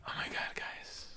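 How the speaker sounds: breathy whisper to chat. Real, not acted. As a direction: whisper it to the viewers. Hushed aside to viewers.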